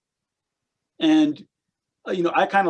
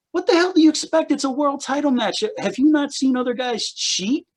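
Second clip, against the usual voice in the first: exasperated outburst; 9 semitones higher than usual. Retold or imitated. Answering the wrestlers' complaints, exasperated high outburst. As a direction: throw your hands up at them.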